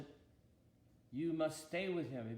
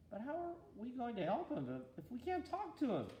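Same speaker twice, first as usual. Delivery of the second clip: higher-pitched exasperated angel; 9 semitones higher than usual. A narrator's reading. Angel protesting to God they can't talk to man, exasperated and higher. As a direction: push the question out in frustration, pitch climbing. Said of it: "in exasperation".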